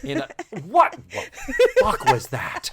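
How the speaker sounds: high, loud incredulous outburst; mock shock. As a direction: blurt it out in comic disbelief. Mock shock at the house coat.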